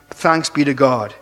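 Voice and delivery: ordinary talk, nothing marked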